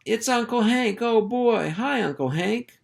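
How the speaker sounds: a thrilled little pup shouting; excited pup. Acted. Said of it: "cried out".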